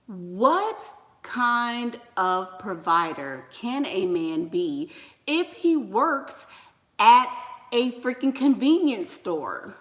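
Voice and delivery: word by word, "What kind of provider" at half speed; slow, emphatic question. Real, not acted. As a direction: spell out "provider" slowly and deliberately. Question repeated slowly for emphasis.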